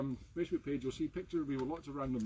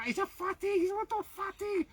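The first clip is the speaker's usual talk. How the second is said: doting pet-talk voice, much higher. Real, not acted. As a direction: coo it up high to the pet. Cooing over the dog.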